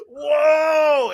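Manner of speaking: loud, high whoa of the jump; startled whoa. Retold or imitated. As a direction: shout it mid-air as you fly up. Jumping off roof onto trampoline.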